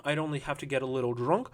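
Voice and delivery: ordinary delivery, nothing marked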